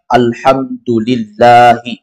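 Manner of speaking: slow, drawn-out Arabic; quoted reading. Shown as a demonstration. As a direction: stretch it out as the teacher's answer. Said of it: "Kata dia". (bacaan kutipan pelan)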